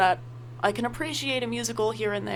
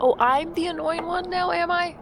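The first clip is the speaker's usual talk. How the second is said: higher and louder mock outrage; sarcastic retort; 9 semitones higher, 4 dB louder than usual. [name] mocking the sister's complaint, sarcastic. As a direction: throw it back sarcastically.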